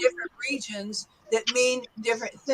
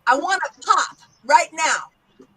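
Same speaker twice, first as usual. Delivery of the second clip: higher-pitched New York accent; 4 semitones higher than usual. Shown as a demonstration. Teacher models for students, New York accent. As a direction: punch out "pop" like a New Yorker. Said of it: "let's try this in a New York accent".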